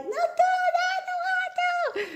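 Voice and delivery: put-on very high child's wail of no; child fighting the trousers, very high and wailing; protesting child. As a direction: squeal it like a toddler fighting clothes. Kid screaming while being dressed.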